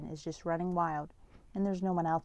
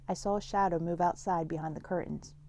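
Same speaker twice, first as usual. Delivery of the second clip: plain reading voice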